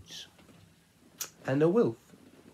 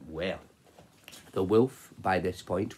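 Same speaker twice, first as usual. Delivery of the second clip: ordinary delivery, nothing marked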